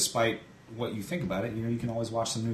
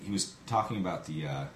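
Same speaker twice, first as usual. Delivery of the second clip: normal talking voice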